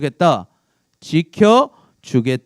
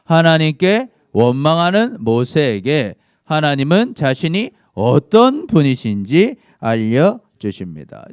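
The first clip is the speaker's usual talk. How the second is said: slow, louder read-aloud summary; slow group reading; 5 dB louder, slower than usual. Real, not acted. Teacher leading the class in reading the summary, slow and loud. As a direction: read it out slowly for the class to follow. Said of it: "우리 정리한 거 읽어볼까요?".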